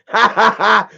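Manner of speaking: real laugh. Real, not acted. Laughs at own joke.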